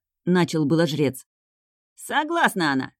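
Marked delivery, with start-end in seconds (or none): 1.9-2.9 higher, cutting-in retort; impatient. A narrator's reading. Brunet answers for the bride, impatiently interrupting the priest. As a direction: interrupt with exasperated haste. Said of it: "нетерпеливо перебил его Гаин".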